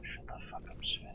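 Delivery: hissed whisper at the companion; whispered scolding. Real, not acted. Gamer sneaking, whispering angrily at Sven. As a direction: scold him in a hushed whisper.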